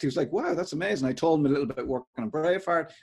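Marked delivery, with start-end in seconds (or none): 0.3-1.1 higher-pitched mock amazement; impressed. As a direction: play the impressed interviewer. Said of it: "he was impressed".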